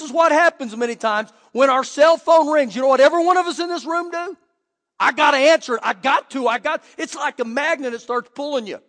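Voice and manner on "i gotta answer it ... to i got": louder, higher frantic insistence; urgent compulsion. Acted. Preacher acts out everyone rushing to a ringing phone, urgent compulsion. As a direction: blurt it out like you can't resist.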